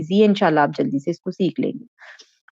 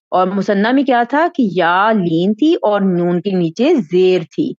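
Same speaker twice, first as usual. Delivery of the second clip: ordinary talk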